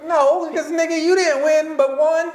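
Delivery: high-pitched, exasperated challenge; indignant arguing. Acted. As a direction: argue back in an incredulous high voice. Mock argument addressed to quarterback.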